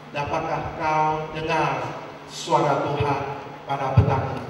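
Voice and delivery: ordinary talk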